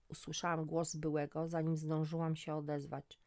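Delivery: ordinary delivery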